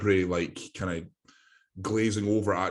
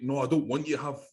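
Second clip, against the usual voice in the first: pitched-up exasperated telling-off of a client; a retold rant, high and worked up; mock exasperated scolding; 6 semitones higher than usual. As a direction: scold the client like a fed-up coach. Coach yelling at client over doms.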